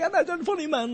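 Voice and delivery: a clumsy imitation of a Japanese listener's accent; mock Japanese accent. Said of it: "I don't even know how to do these accents".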